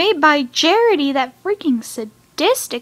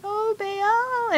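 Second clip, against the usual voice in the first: high, soft, pitying croon; retold tearful sympathy; 8 semitones higher, 6 dB quieter than usual. Fan moved by the hero touching the essence, tearfully pitying. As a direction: coo it high and small, near tears. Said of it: "when I really started crying".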